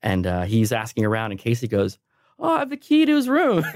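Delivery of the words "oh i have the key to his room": very high mocking voice with a laugh; comic squeaky impression of a colleague; mimicked coworker. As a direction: imitate a blithely helpful coworker, pitched way up. Mimics coworker who betrayed him.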